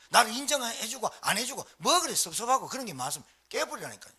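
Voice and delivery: ordinary talk, nothing marked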